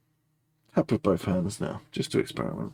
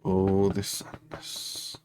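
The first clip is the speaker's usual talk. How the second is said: slow drawn-out; much slower than usual. Acted. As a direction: stretch each word, much slower. Repeated, drawn out slowly.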